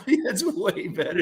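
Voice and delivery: slow, high and giggling; laughing. Real, not acted. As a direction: still laughing as it settles. Reacting to the fixed audio.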